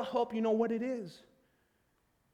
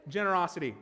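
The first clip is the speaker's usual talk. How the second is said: ordinary talk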